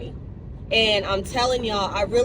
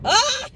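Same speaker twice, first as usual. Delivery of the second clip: high emotional cry-out; tearful squeal; 14 semitones higher, 6 dB louder than usual. Real, not acted. Moved by how much she has grown, high tearful squeal. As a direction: let out a high, loud "ah" as feeling wells up. Overcome looking at her growth.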